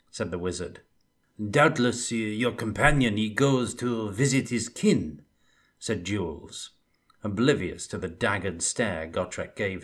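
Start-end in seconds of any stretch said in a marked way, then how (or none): 1.5-5.2 put-on Bretonnian accent. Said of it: "He had a Bretonnian accent".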